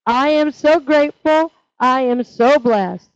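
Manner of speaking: slow, firm recital leading the group; slow recited affirmation. Shown as a demonstration. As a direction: stretch each word of "I am so grateful" for them to echo. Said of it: "Will you say that with me?".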